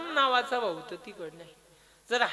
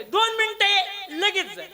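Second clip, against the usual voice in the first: raised, high-pitched call; calling over; 9 semitones higher, 5 dB louder than usual. Acted. Krishna asks his brother to come briefly, calling over. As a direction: urge him across from afar.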